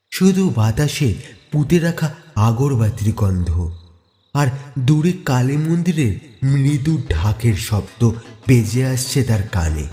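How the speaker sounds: usual speaking voice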